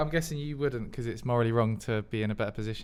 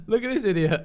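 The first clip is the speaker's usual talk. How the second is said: mock announcer; 9 semitones higher, 9 dB louder than usual. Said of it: "a mascot for Knowlton".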